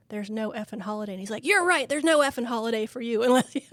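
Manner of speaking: ordinary talk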